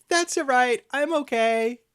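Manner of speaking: high, loud self-reassurance; imitating brave child. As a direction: call it out high like a small kid. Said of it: "and I hear".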